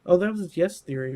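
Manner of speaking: usual speaking voice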